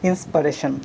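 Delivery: "Inspiration" said clearly as the correct form; correct pronunciation. Shown as a demonstration. A teacher answering her own question to the class, modelling the word. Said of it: "What is it?".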